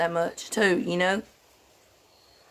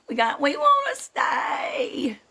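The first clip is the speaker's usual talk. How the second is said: put-on whining kid; 6 semitones higher, slower than usual. Kids begging to stay over.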